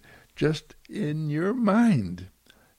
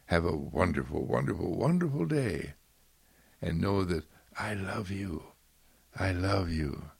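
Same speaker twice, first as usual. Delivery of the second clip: tender blessing; 5 semitones lower than usual. Real, not acted. Loving blessing closing the sermon.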